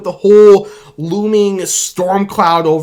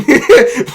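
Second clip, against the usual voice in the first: giddy laugh; 9 semitones higher, 6 dB louder than usual. Real, not acted.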